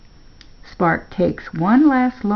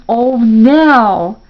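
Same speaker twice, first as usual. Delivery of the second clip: glad, louder cry of greeting; excited; 5 semitones higher, 9 dB louder than usual. A narrator's reading. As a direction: call out to an old friend. Greeting his horse gladly.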